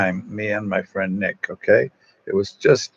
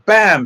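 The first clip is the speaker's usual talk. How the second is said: mock gunshot; 10 semitones higher, 6 dB louder than usual. Imitating a gunshot.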